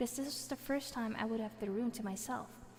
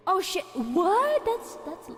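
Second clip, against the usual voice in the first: loud, high, slow "oh shit, what"; stunned by the size; big shocked outburst; 7 semitones higher, 10 dB louder, slower than usual. Real, not acted. Sees how big the statue is.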